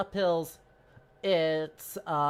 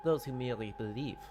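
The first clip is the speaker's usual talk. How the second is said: a French gentleman politely correcting, in a hammed-up French accent; terrible French accent. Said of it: "I have to do a terrible French accent".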